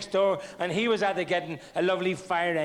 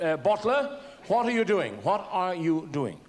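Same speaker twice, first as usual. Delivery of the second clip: stern teacher voice; 3 semitones higher than usual. Retold or imitated.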